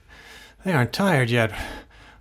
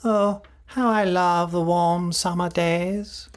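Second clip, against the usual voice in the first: dreamy feverish; 6 semitones higher, slower than usual. Acted. Feverish husband drifting off.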